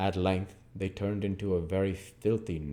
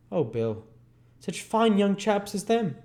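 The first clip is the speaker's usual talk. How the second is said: put-on higher, lighter young woman's voice; Nancy sighing to Bill over the condemned lads; Nancy's voice; 13 semitones higher, 3 dB louder than usual. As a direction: play Nancy, wistful over the young men.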